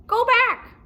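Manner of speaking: very high, loud squawk; parrot voice. Acted. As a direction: screech it like a parrot. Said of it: "said Polly".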